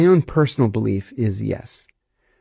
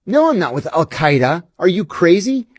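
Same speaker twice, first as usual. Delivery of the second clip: incredulous denial; 5 semitones higher than usual. Retold or imitated. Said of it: "You're with Al-Qaeda".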